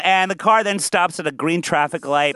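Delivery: normal talking voice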